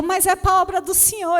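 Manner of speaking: high, loud indignant protest; pleading protest. Retold or imitated. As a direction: protest as if caught out unfairly. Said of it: "E eu".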